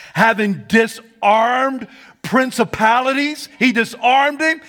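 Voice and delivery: slow, lifted pulpit reading; preacher reading Colossians aloud; preaching scripture. Acted. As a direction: lean into "disarmed".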